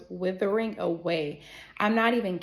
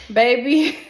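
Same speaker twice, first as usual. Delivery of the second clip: dramatic exclamation; 4 semitones higher, 10 dB louder than usual. Real, not acted. Storyteller as the medication hits her, dramatic exclamation. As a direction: belt it out for emphasis, pitched up.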